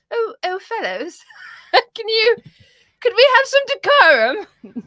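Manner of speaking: high, pleading posh host begging for order; high, prissy voice of the presenter asking for decorum; prim British presenter. Retold or imitated. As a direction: play a flustered radio host calming two guests. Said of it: "the presenter was like".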